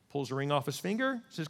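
ordinary talk

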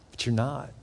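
ordinary talk, nothing marked

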